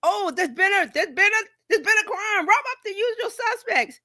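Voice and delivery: high, hammed-up officer's outcry; Casablanca officer. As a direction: play the shocked officer, overdone. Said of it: "that scene from Casablanca".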